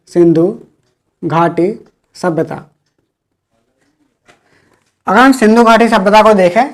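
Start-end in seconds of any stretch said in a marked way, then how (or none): 0.1-2.6 slow, soft, word by word as if dictating; slow dictation. Shown as a demonstration. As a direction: spell it out slowly for notes. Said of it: "teacher repeats term for notes". (धीरे धीरे, लिखवाते हुए)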